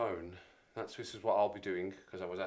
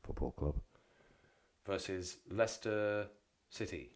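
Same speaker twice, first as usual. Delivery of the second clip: fixtures announcer voice; 4 dB louder than usual. Pretend. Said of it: "Back into character".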